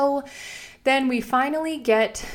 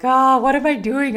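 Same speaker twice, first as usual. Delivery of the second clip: embarrassed dismay; 6 semitones higher, 7 dB louder than usual. Retold or imitated. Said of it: "feeling kind of, like, dumb".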